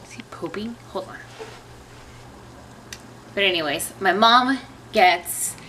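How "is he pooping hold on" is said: soft unvoiced whisper. Real, not acted. Baby sleeping nearby.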